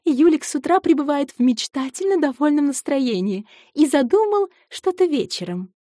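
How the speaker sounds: put-on bright young woman voice. Voiced female character, bright.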